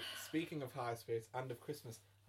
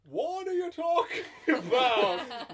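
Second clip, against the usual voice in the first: high, loud stand-up comic Santa; indignant, hammed-up American whine; Jerry Seinfeld; 12 semitones higher, 14 dB louder, slower than usual. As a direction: stretch it out in exaggerated disbelief. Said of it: "Jerry Seinfeld?".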